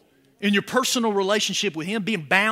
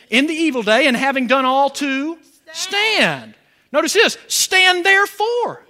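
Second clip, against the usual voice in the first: loud, higher proclaimed verses; preaching scripture; 6 semitones higher, 5 dB louder than usual. Acted. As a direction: ring the word stand out to the church. Proclaims scripture at full force.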